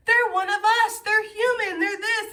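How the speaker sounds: high, gleeful gossip voice; high, loud mock gossip; mock gossiper voice. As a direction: play the nosy gossiper catching you slipping.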